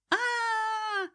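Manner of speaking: long, very high scream; held high wail of fear; terrified wail. A narrator's reading. Cousin about to be dropped, screaming in terror. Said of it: "завопил тот на всю округу".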